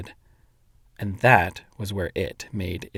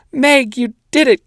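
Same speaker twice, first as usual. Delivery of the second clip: very high, loud joyful shout; 14 semitones higher, 9 dB louder than usual. A narrator's reading. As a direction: shout it in triumph. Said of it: "Calvin shouted".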